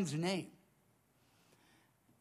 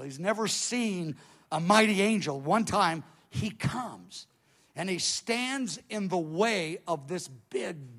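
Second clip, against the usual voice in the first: normal talking voice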